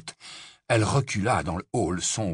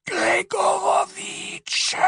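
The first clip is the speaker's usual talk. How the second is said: very high, slow, drawn-out cold call; icy, high-pitched dark lord; cold and shrill-high, stretched out; 4 dB louder, much slower than usual. A narrator's reading. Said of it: "une voix froide et haut perché".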